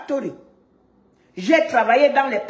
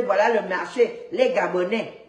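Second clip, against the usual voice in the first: put-on Beninese French, pitched lower; mocking Beninese accent; 4 semitones lower than usual. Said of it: "Vous avez entendu leur accent".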